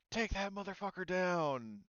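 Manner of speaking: rally the squad to kill the target; commander's order. Acted. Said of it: "he's like".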